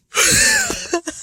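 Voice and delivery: very high, loud laughing squeal; overwhelmed squeal. Real, not acted. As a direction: let out a piercing, overwhelmed shriek. Overwhelmed by the plot twist.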